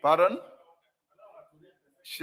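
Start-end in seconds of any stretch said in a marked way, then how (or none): 0.0-0.4 sharp surprised interjection. Real, not acted. Speaker interrupts the clerk.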